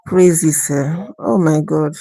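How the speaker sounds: usual speaking voice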